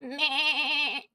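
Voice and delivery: goat bleat. Shown as a demonstration. Streamer performs goat impression, quavering bleat. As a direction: bleat like a goat on one long note. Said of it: "I need to do my goat impression now".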